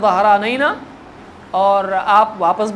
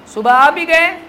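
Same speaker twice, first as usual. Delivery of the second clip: mock disbelief; 6 semitones higher, 4 dB louder than usual. Mocking crowd doubts the journey.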